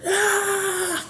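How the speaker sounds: high mock scream in the ear; a sudden high shriek; retold scream. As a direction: squeal it out, piercing and high. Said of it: "the guy screams as loud as he can".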